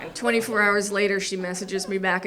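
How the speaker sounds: normal talking voice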